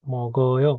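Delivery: "মগয়" with the g carried into the next vowel; linked pronunciation. Shown as a demonstration. Teacher showing learners where the giyok moves, linked pronunciation. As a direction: run "মগয়" together, final vowel drawn out. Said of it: "এটার উচ্চারণ হয়ে যাবে".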